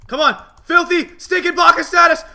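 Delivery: high, loud frantic yelling; excited shouting. Real, not acted. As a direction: scream at the screen in the fight. Cheering on a fight, then losing.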